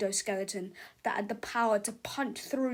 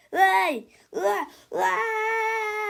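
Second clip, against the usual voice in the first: high, loud frightened yelps; panicked cries; 10 semitones higher, 8 dB louder, much slower than usual. Acted. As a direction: shriek as the robot grabs you. People cry out.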